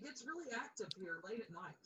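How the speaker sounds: hushed, unvoiced whisper. Real, not acted. Telling a friend the house gets active at night, in a whisper. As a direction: breathe it like a secret. Spooky aside about night hauntings.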